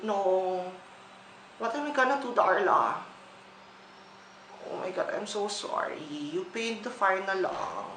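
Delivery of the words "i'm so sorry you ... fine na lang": ordinary talk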